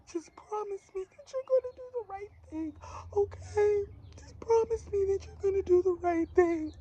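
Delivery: high, tearful, crying plea; crying mother. Retold or imitated. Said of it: "that's how she was sounding to me".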